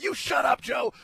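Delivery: shouting at the singer in a bar; teasing shout. Retold or imitated. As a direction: heckle him back, all in fun. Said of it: "I said".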